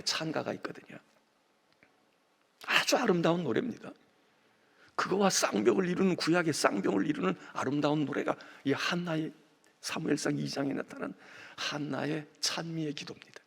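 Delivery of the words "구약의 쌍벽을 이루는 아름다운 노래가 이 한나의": normal talking voice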